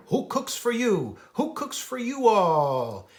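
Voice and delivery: big hooting owl question. Acted. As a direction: hoot it out like a barred owl asking. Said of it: "the deep voice of a barred owl".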